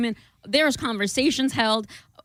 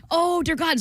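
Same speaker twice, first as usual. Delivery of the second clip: mortified; 8 semitones higher than usual. Retold or imitated. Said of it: "And I'm like".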